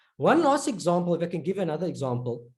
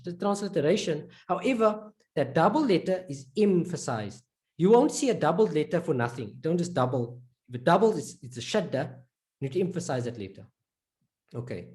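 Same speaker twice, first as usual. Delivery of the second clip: ordinary talk, nothing marked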